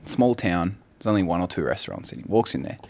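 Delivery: ordinary talk, nothing marked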